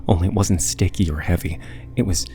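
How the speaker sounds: ordinary delivery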